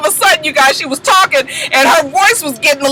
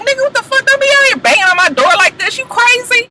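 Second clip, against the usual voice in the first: mock yell; 12 semitones higher than usual. Baby Knees catches him at door.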